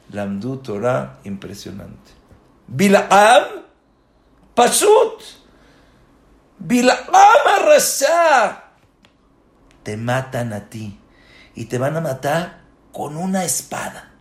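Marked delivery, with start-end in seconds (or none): 2.7-8.6 scornful exclamation. Acted. Preacher mocking Bilam's fate.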